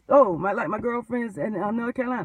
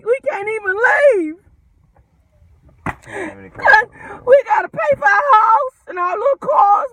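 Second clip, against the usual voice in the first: much higher, loud crying shout; hammed-up wailing slave; 9 semitones higher, 9 dB louder than usual. Mock runaway slave wailing about overtime and car payments, loud and high. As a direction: wail it like a mock lament. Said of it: "it's like the runaway slave said".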